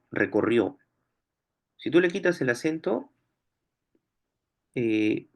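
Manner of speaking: ordinary talk, nothing marked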